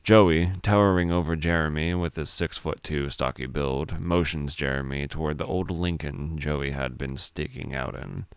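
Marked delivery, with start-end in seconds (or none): none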